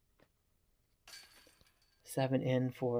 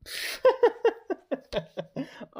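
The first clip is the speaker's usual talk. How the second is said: squealing high laugh; high disbelieving laugh; 4 dB louder than usual. Real, not acted. Player laughing at his own blunder, high laugh. After the terrible throw.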